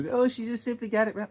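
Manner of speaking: high, mocking put-on voice; dismissive mimicry. Everybody excusing her mistake, mock high voice.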